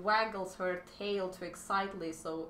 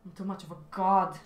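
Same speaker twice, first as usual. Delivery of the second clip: mock arrogant; 7 dB louder than usual.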